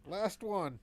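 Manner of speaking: ordinary talk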